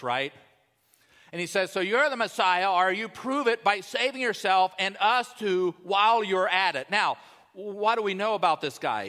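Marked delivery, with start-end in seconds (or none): 1.7-6.9 loud, raised, arrogant criminal taunt; hurled insult. Acted. First thief hurls insults at Jesus, loud arrogant scoffing. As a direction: throw it out like a smart aleck. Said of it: "hurling the insults at Jesus".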